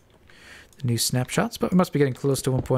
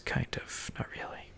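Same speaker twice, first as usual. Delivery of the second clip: whispering; 14 dB quieter than usual. Real, not acted. Muttered aside to self.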